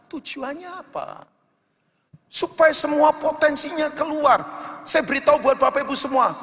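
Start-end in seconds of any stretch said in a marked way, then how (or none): 2.3-6.3 loud, fervent sermon over music; loud, higher impassioned preaching; full-force preaching. Acted. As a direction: preach it out, pushing the congregation. Preaching climax to amen call.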